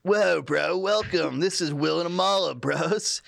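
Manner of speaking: lightly done California bro voice; easy laid-back surfer bro; California surfer accent. Pretend. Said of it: "Amala needs a California one then".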